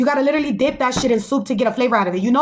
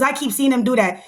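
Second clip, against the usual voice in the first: ordinary talk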